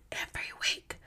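breathy secret whisper, no voice at all. Acted. Podcaster whispers the weekly promise to her listeners. Teasing new weekly episodes.

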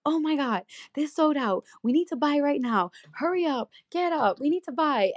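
high-pitched, urgent retold panic; freaking out. Roommate waking her at three in the morning, freaking out. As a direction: imitate a frantic wake-up call. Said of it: "freaking out".

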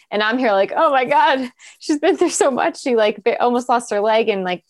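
real tears rising in the voice; tearful. Real, not acted. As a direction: get choked up while telling it. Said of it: "I'm crying".

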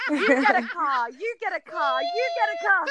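drawn-out, climbing mock announcement; giveaway host. Mimics a car giveaway host.

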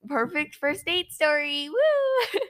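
slow ironic build to a high cheer; mock cheer. Mock celebrating the disaster date.

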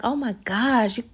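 loud, amazed exclaim over the food; wide-eyed, over-the-top amazement; overly impressed gushing. Retold or imitated. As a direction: gush like you've never seen a stocked pantry. Guest gushing over full pantry.

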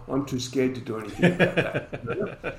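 said through a laugh, mock-fearful. Translating umpire speak for the host, laughing. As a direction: chuckle through the umpire's hidden meaning. Mocking the umpire's real meaning.

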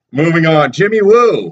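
high, sung-out name reveal; playful announcement. Pretend. Host moves on to Jimmy Woo, playful announcement. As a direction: lift the pitch high and announce him.

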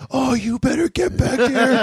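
high, strained mock yell kept soft; a hint of a shouted threat held quiet; quiet scream. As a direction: scream under your breath like a storyteller. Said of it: "the quiet scream".

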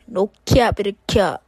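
very loud, playful catchphrase yell; shouted sign-off. Pretend. Host signing off to listeners after the outro, playful shout. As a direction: blast the catchphrase out at full volume.

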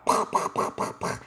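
voiceless popping gun noises; pop-pop shots with the mouth; mimed gunshots. Acted. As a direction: imitate rapid toy gunfire. Imitating gunfire in story.

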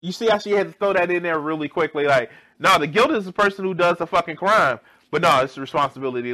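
loud, fast, high exasperated ranting. Real, not acted. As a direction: rattle off the anger. Ranting at a clip.

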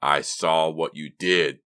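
slow, loud, hoarse threat; menacing croak. A narrator's reading. Anonymous caller repeats threat to girl, menacing croak. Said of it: "the voice croaked low and menacing".

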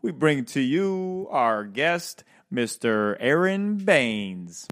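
loud, high, drawn-out host hype; hyped announcer intro. Acted. Host introducing the first podcast guest with loud hype.

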